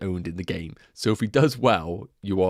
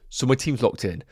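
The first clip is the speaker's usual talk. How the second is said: ordinary delivery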